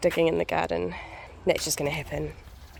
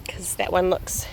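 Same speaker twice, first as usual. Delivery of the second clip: ordinary delivery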